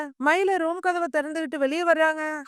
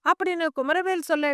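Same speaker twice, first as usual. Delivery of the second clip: ordinary delivery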